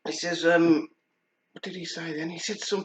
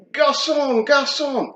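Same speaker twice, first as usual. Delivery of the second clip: mock French call; 9 semitones higher, 4 dB louder, slower than usual. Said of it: "he did the proper French thing".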